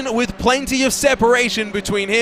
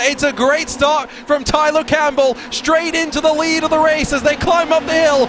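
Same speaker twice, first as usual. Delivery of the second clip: higher-pitched, urgent race-start commentary; announcer riding the action at a raised pitch; live race play-by-play; 4 semitones higher than usual. Real, not acted. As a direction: call the opening lap with sustained excitement.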